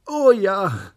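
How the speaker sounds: put-on high, loud, delighted child; excited cry. A narrator's reading. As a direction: burst out with a big yes. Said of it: "rief der kleine Tag".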